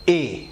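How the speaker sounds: "اے" alone as a sharp A; sharp sound. Shown as a demonstration. Teacher modelling the letter A for learners, sharp sound. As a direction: model "اے" starting straight on the vowel, without y. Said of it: "ایک تیز sound آپ کی زبان سے نکلے گا".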